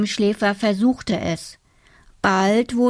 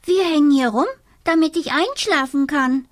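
high, eager little creature; small high dormouse voice; 6 semitones higher, faster than usual. Acted.

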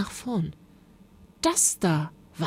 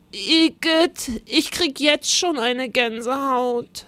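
high, queasy voice for Paul; squeamish disgust; 7 semitones higher, 3 dB louder than usual. A narrator's reading. Paul to Rosa before the ghost train, squeamish and high. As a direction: recoil with a high shudder of disgust.

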